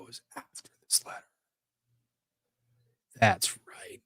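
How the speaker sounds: soft whisper, thinking aloud; whispering to herself. Real, not acted. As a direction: murmur it as a private reminder. Muttering to herself while searching.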